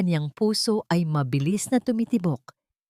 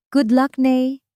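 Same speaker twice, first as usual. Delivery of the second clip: mocking; 6 semitones higher, 6 dB louder, slower than usual. A narrator's reading. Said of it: "bulong ni Amara sa hangin".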